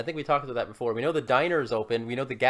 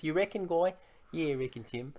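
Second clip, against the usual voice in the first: put-on light New Zealand accent; Kiwi accent; 7 dB quieter than usual. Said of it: "they talk in those Kiwi accents".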